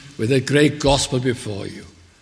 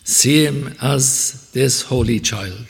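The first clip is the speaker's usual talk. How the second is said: ordinary delivery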